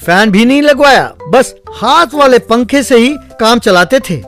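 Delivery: ordinary delivery, nothing marked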